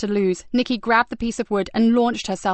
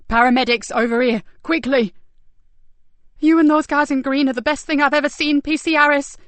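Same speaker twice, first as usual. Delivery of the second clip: urgent shouting; 5 semitones higher, 5 dB louder than usual. Acted. Calling paramedics urgently.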